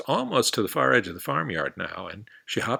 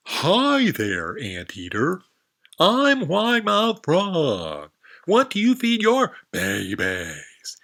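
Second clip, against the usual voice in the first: very high, loud, slow frog greeting; a big-mouthed frog shouting hello; wide mouth frog voice; 9 semitones higher, 7 dB louder, much slower than usual. Acted. Frog introducing herself to the lizard, high loud voice.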